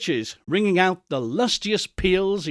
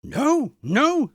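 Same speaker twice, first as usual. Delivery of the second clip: eager denial; 10 semitones higher than usual. Acted. Scrooge eager.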